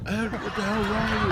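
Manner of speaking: high, loud mock anger through a laugh; giggling fake rage; laughing outrage. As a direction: play the victim's fury while cracking up. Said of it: "Why would you".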